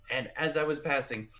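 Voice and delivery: ordinary delivery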